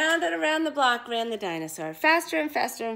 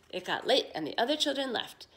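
ordinary delivery, nothing marked

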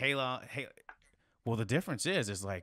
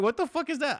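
much higher and louder, stunned; retold shocked outburst; 9 dB louder than usual. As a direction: shout it out like you just saw something impossible. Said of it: "I'm like, I'm like".

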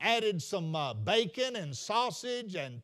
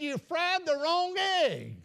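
a higher, slow put-on husband voice; grumpy husband; 6 semitones higher, slower than usual. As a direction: deliver the husband's complaint slowly and pointedly. Husband's punchline complaint.

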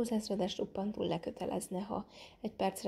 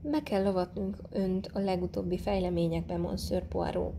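ordinary delivery, nothing marked

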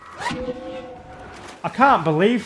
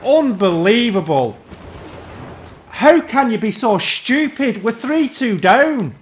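real raging; 5 semitones higher than usual. Real, not acted. Gamer to teammate Leia hiding in the trees, raging. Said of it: "I'm raging again".

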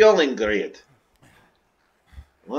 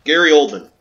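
louder burst as the answer hits; excited recognition; 2 semitones higher, 11 dB louder than usual. Real, not acted. Gamer remembering the actor behind Reznov, a loud sudden blurt. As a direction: blurt out the name you just remembered. Realizing who voiced the character.

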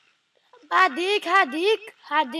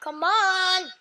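ordinary talk

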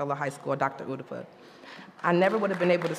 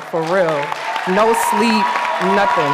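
emphatic loud; 2 semitones higher, 9 dB louder, slower than usual. Real, not acted. Shout out to her girls.

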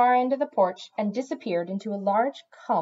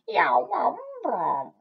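complaining yowl; 6 semitones higher, much slower than usual. A narrator's reading. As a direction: yowl like an aggrieved cat. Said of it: "in a complaining to-, tone".

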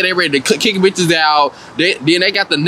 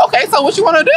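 mock challenge; 11 semitones higher, much faster than usual. Girl gets up to fight.

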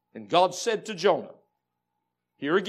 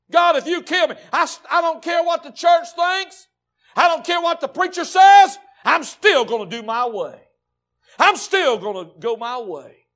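much higher, much louder shouted defiance; furious shout; 10 semitones higher, 12 dB louder than usual. Acted. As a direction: scream stubborn rebellion at God and church. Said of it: "He said".

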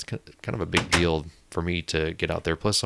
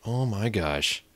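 usual speaking voice

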